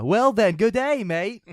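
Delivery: high, swooping Aussie movie quote; mock Australian accent. Host quoting Dumb and Dumber, high mock Australian accent. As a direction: pitch it up into a goofy Australian greeting. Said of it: "Dumb and Dumber is so fucking good".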